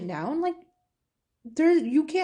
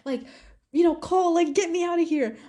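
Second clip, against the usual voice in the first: raised pitch, imitating a panicked plea; retold tearful plea; 4 semitones higher than usual. Daughter calling mom from the bathroom, retold panicked pleading. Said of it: "crying literally in the bathroom".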